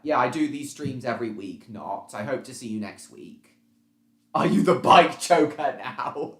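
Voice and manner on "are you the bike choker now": loud question breaking into laughter; amused laughing. Real, not acted. Streamer reading a chat question aloud, laughing. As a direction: read it out higher and louder, cracking up.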